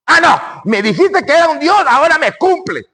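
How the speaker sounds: high, insistent voice of a demanding believer; mock indignant demand. Believer holding God to his word, mock indignant demand.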